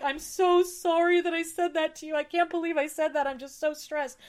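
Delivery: high, pleading retold apology; imitated tearful apology. Retelling a call to the landlord to apologise, tearfully pleading. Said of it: "I called her crying and being like".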